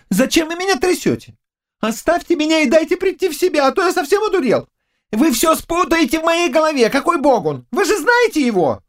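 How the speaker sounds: very high, fast, protesting youth; flustered young servant. Acted. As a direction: gabble complaints while being shaken. (растерянный юный слуга, высокой скороговоркой)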